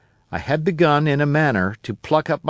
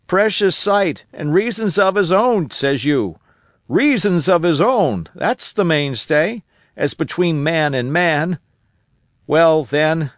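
put-on a higher, eager old sailor voice; old castaway Ben Gunn; 4 semitones higher than usual. Old castaway telling Jim about Flint, eager and higher. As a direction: spin the tale of Flint like a wild old castaway.

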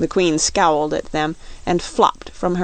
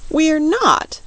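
put-on high-pitched, mildly indignant girl; 10 semitones higher, 4 dB louder than usual. A narrator's reading. Dorothy denying to the Queen they are soup, indignant. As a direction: protest it high, a touch offended. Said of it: "said Dorothy indignantly".

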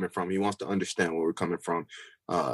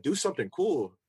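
lightly imitated teammate, pitched up; funny voice; 7 semitones higher than usual. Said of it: "it was in a funny voice".

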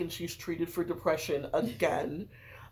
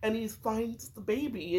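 ordinary delivery

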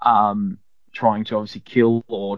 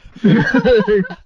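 loud, higher burst of laughter; laughing; 10 semitones higher, 6 dB louder than usual. Real, not acted. Player reacting to a silly accent, laughing.

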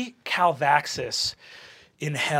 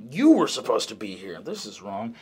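demon voice; 8 semitones lower than usual. Acted. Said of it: "and says".

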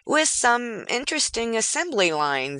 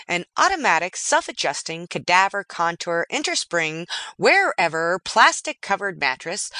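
ordinary delivery, nothing marked

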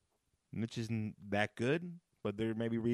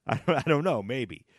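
ordinary talk

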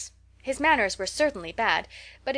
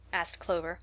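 ordinary delivery

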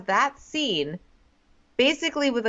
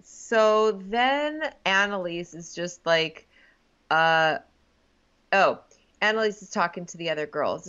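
usual speaking voice

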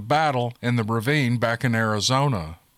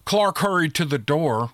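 ordinary delivery, nothing marked

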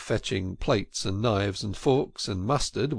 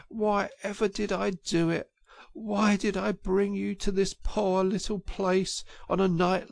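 doleful self-reproach; 8 semitones higher than usual. A narrator's reading. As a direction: blame yourself mournfully. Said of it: "his doleful self-reproaches".

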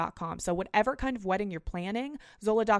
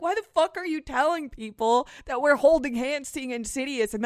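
louder, higher angry tirade; retold furious rant; 4 semitones higher, 6 dB louder than usual. Friend calling her out in the lunchroom, mock furious. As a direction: hammer it home like he's done with her. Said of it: "He's like".

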